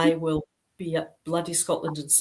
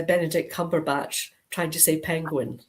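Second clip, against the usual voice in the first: ordinary talk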